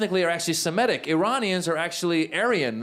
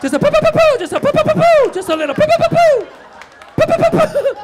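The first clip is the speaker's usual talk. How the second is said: very high, loud "poo poo" bursts; comic high-pitched squeezing noises; silly squeaky sound effect; 10 dB louder than usual. Acted. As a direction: squeal it out way up high, much louder.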